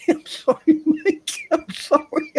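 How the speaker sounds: real laughter breaking through sorry; laughing apology. Real, not acted.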